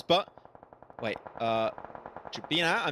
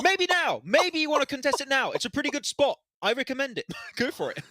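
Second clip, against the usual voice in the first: high, louder mock taunting; teasing; 10 semitones higher, 3 dB louder than usual. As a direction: bait them playfully. Teasing rival over the mic.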